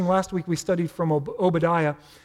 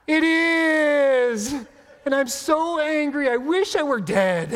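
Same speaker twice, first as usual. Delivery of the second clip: put-on overly dramatic spoiled teenager; 8 semitones higher, slower than usual. Pastor hamming up Jonah as a spoiled teenager, bratty wail. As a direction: ham it up like a spoiled teen denied a new phone. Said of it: "like an overly dramatic, spoiled teenager".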